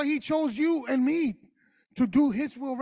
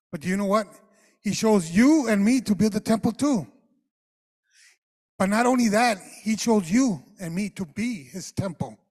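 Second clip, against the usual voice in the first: loud, ringing preaching; preaching at full force; 8 dB louder than usual. Acted. Pastor tells church they are God's temple, loud preaching. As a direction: proclaim it to the whole church, louder.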